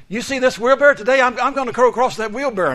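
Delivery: loud, high, bragging announcement; showman's loud call. Retold or imitated. Daredevil boasting to the watching crowd, loud and high.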